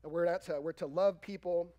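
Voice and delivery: normal talking voice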